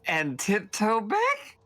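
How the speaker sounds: slow, drawn-out doubt, rising high at the end; incredulous. Acted. Player's character questions the sneaking plan, slow and incredulous. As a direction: stretch it out into a disbelieving question.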